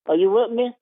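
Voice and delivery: ordinary delivery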